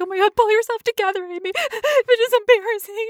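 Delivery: high, tearful self-talk; self-scolding while crying. Retold or imitated. Alone in the bedroom crying, high tearful self-scolding. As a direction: scold yourself through tears. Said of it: "and I'm like".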